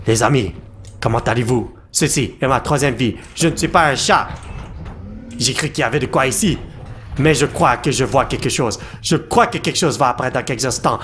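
hushed vlogger narration. Acted. Hushed horror vlog narration.

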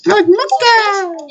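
MOCHA pitched far up, stretched and falling; high squealed MOCHA. Shown as a demonstration. Gamer correcting friend's pronunciation, high squeal. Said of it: "you've been saying it wrong".

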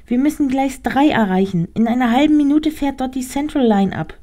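high, small directing rat voice. Acted. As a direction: point the way as a tiny rat. Rat keeps instructing.

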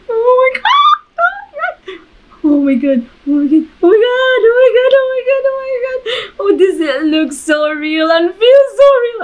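high, loud frightened cries; real fright, near tears; panicked. Real, not acted. Repeated panicked exclamations on ride.

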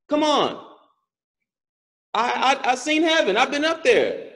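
acted-out frustrated plea; mildly desperate begging; pleading. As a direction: protest to God in disbelief. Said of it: "I pleaded with the Lord".

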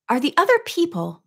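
sudden question. A narrator's reading. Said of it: "Nancy said suddenly".

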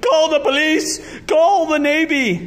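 loud, high cry for help; shrieking, alarmed coach; panicked shriek. A narrator's reading. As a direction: yell orders in a hammed-up panic. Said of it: "shrieked the coach".

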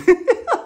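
loud high-pitched laugh. Real, not acted. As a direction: laugh it off brightly. Laughs at weird relationship.

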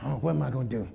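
quiet, low fretting aside; mock worried sigh. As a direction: sigh it out like a worried broke parent. Preacher voices worried broke person.

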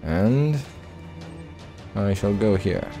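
ordinary delivery

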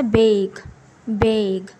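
बेग said with a clear long ay vowel, not a flat bag sound; teacher modelling बेग twice; long a demo. Shown as a demonstration. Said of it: "वो ए करके निकलेगा".

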